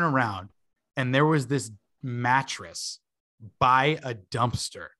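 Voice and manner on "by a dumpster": ordinary talk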